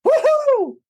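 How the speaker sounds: high, loud whoop; excited cheer. Acted. Monkey cheering at the chance of chocolate. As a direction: cheer like a thrilled kid.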